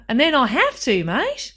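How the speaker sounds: high, swooping mock Australian; teasing put-on Australian with rising ends; Australian accent. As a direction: slip cheekily into a hammed-up Australian accent. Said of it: "forgotten about the Australian accent".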